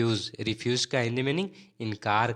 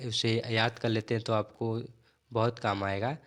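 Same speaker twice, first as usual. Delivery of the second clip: ordinary talk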